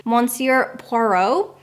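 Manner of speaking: plain American accent on "Monsieur Poirot". Shown as a demonstration. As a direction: say it slowly with American r's. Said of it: "say it in my American accent".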